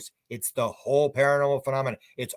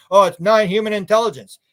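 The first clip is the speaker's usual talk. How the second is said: higher, louder mock-official insistence; mock word game; 6 semitones higher, 8 dB louder than usual. Mimicking officials dodging the word E T, mock insistence. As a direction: play up the evasive term, loud and raised. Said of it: "We're playing that game".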